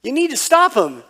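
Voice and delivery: put-on high, pleading voice of a kid complaining to Dad; whiny kid tattling. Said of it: "I'm like".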